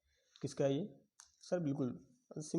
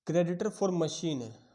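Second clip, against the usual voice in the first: dictated question; 3 semitones higher, 7 dB louder, much slower than usual. Shown as a demonstration.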